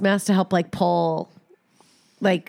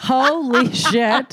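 slow drawn-out laugh; laughing through the words; 4 semitones higher, 3 dB louder, much slower than usual. Real, not acted. Reacting to a funny story.

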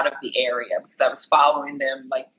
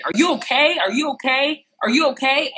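high, insistent calling out; urgent concern; 10 semitones higher than usual. Retold or imitated. Woman calling from her window to the victim, urgent concern. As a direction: imitate a worried bystander, pitched well up.

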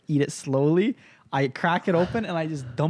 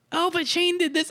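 mom voice. Retold or imitated. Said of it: "She goes, uh".

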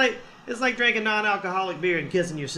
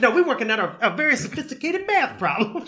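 high voice, lightly hammed-up; retold genius kid; 7 semitones higher than usual. Kids figuring out the chicken force, mock-serious. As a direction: protest in a raised kid voice. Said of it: "They were like".

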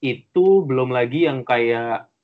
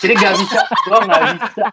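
loud, higher-pitched words through laughter; laughing; 4 semitones higher, 6 dB louder than usual. Real, not acted. Confessing to friends they can't meditate, laughing. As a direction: crack up while protesting helplessly. Cracking up after singing the song.

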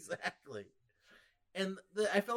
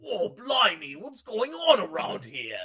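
put-on loud, higher, drawn-out British accent; hammed-up British delivery man; 5 semitones higher, 7 dB louder, slower than usual. Host imitating the UPS guy, over-the-top British accent. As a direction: exaggerate a cartoonish English "blimey". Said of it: "even that U P S guy was like".

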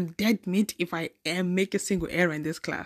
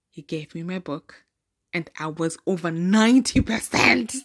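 ordinary delivery

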